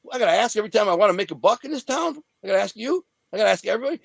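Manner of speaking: retold indignant back-talk; indignant protest. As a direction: protest in disbelief, each question lifting at the end. Indignantly talking back to boss.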